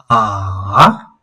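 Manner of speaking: long 'ah' that dips and rises; third tone. Shown as a demonstration. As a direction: sink low on 'ah', then lift sharply. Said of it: "Once more".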